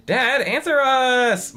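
demanding. Acted. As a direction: demand an answer from dad. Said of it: "Margaret demanded".

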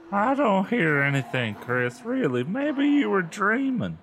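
high, drawling dismissive sister voice; Lindy. A narrator's reading. Sister telling Chris she was dreaming, high drawl. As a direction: brush her off in a high voice.

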